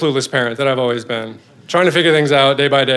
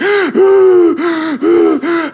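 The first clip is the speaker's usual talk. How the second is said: sobbing gasp imitation; 13 semitones higher, 3 dB louder than usual. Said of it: "He's like".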